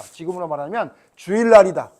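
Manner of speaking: normal talking voice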